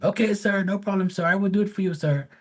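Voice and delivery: high, eager and deferential; polite pool guy voice. Retold or imitated. Pool guy accepting the homeowner's request, high and eager. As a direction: imitate the pool guy promising to do it.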